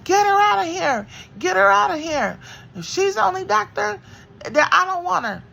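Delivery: very high, loud screaming demand; retold screaming. Woman in labor screams at the nurses to get the doctor out. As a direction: scream it from the hospital bed. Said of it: "I was just screaming".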